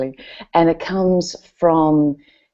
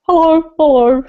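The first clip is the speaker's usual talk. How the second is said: timid greeting to dog; 12 semitones higher, 7 dB louder than usual. Retold or imitated.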